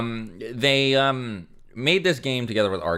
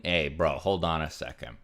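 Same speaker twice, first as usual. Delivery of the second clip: ordinary talk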